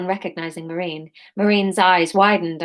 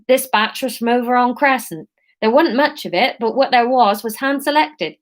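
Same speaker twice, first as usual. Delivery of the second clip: higher Somerset seller voice; Somerset accent; 3 semitones higher than usual. Acted. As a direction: keep the Somerset burr going. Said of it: "this is gonna be Somerset".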